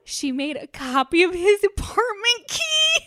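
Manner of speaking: high, loud and slow in disbelief; incredulous. Real, not acted. Host to cohost, aghast at the copied key, high and loud. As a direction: stretch it out, can't believe it. Stunned retelling of aunt's intrusion.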